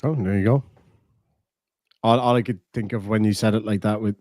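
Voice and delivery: normal talking voice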